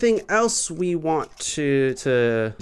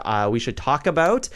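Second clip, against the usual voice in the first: ordinary delivery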